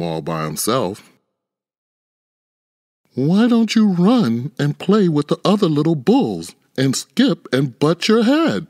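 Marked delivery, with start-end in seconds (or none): 3.1-8.7 higher, gentle coaxing mother; mother cow's voice. A narrator's reading. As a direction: coax him like a caring mother cow. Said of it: "she would say".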